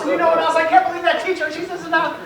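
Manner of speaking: high-pitched imitated kid's rant; ranting daughter. Daughter following dad around complaining about teacher, high-pitched rant.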